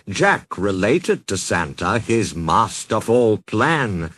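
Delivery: usual speaking voice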